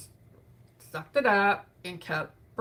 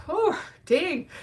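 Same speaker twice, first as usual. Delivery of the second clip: high, loud cry of grief; the grief hits all at once; grief welling up; 10 semitones higher, 7 dB louder than usual. Real, not acted. Grief catches her by surprise.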